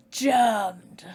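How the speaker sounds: loud game-character exclamation; single loud, put-on cry; Clive Barker's Undying hero. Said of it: "what's his name from Clive Barker's Undying".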